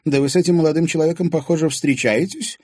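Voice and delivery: put-on old lady surprised at the couple; surprised question. A narrator's reading. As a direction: raise the eyebrows in your voice. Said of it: "вскинула брови".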